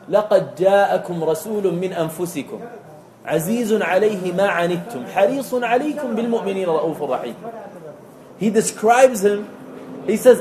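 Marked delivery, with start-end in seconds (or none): 0.1-7.3 rapid chanted Arabic recitation; fast melodic Quran recitation; Quranic recitation. Acted. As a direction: recite the verse quickly, chant-like.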